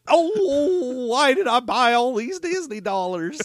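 put-on high whining sob, old coot; blubbering over worthless money; crying old prospector.

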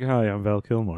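low, quiet celebrity voice; Val Kilmer impression. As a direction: mumble it like a tired star. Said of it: "He'd be like".

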